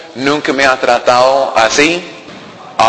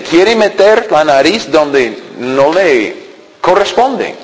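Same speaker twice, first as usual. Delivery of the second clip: ordinary delivery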